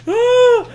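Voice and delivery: loud, high startled yell; mock scared scream. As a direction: yell in sudden comic fright. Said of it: "surprised, scared".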